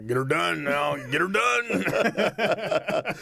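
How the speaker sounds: drawn-out coach holler, rising high; Coach Lay impression. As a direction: bark it like the old coach. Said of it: "Give me your best Coach Lay voice".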